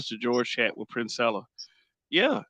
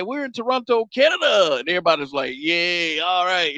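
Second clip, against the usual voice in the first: higher, hammed-up arena greeting and crowd cheer; mock wrestler shout-out; 5 semitones higher, 4 dB louder than usual. The Rock greeting the Toronto crowd, hammed-up. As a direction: act out the hometown shout and the cheer. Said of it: "He said".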